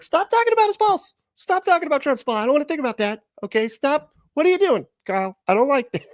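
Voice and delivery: very high, pleading mock complaint; squeaky grossed-out protest at the screen; hammed-up disgusted girlfriend; imitating girlfriend. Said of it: "It's like".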